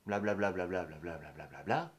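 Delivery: mimicking other people's empty judgement; mocking blabla. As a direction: rattle off dismissive chatter, lifting at the very end. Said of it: "On se dit, en me voyant".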